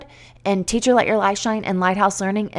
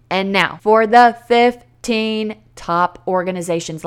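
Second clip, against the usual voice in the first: announcer. Real, not acted. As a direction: introduce the countdown like a host. Announcing the list segment.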